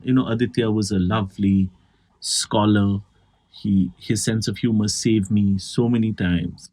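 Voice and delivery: solemn eulogy. Retold or imitated. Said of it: "someone was saying".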